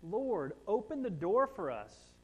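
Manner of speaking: loud pleading cry. Acted. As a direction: beg loudly at a shut door. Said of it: "And they cried out".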